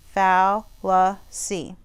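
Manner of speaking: FALLACY broken into three slow syllables. Shown as a demonstration. Teacher showing pupils how fallacy divides, syllable by syllable. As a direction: say Fallacy slowly, split fal-luh-see. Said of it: "it's divided up into syllables".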